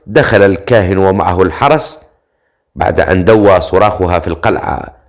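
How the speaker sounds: ordinary delivery, nothing marked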